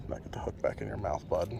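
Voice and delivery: hushed whisper to the fish. Real, not acted. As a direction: whisper gently to the little fish. Softly talking to hooked fish.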